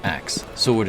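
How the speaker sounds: ordinary delivery, nothing marked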